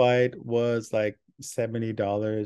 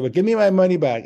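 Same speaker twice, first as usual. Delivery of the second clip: loud demand; 8 semitones higher, 9 dB louder, faster than usual. Retold or imitated. Drunk passenger demanding refund.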